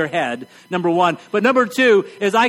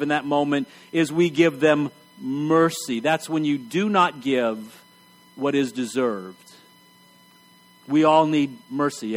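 tender; 6 semitones lower than usual. Acted. Preacher to congregation about mercy, hushed and tender. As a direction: let it sink in gently. Sermon closes softly on mercy.

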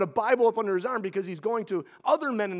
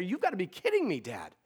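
retold frustration; 6 semitones higher than usual. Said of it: "frustrated and saying".

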